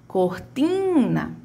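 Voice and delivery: cortina with a very long TI; stress on TI. Shown as a demonstration. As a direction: stretch the middle "ti" of cortina. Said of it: "quem foi que teve a maior". (cortina com o TI bem arrastado)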